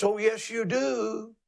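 high, slow crowing rooster; hammed-up rooster call. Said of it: "It's not cock-a-doodle-doo. It's".

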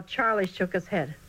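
plain reading voice

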